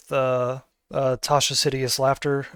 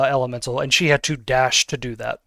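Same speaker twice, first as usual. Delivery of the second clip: ordinary delivery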